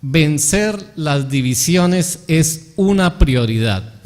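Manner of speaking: very slow, word by word; slow dictation. Shown as a demonstration. Preacher repeats the principle for note-takers, slow dictation. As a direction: repeat it at half speed, spacing out "una prioridad". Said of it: "lo apuntara".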